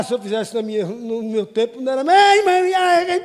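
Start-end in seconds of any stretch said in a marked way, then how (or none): 2.0-3.2 mock child cry. Child crying under a slap.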